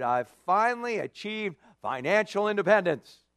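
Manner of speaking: cocky, self-satisfied boasting; a lightly voiced rich fool bragging; smug rich man. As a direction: gloat like a man who has it all.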